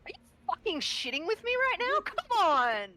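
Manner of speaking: loud, high outraged yell; exploding in disbelief at the roll; angry. Real, not acted. Furious at legendary resistance.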